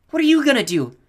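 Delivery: high, loud worried question; anxious yell; alarmed question. A narrator's reading. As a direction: shout after him. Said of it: "Selena said".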